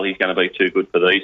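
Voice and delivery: usual speaking voice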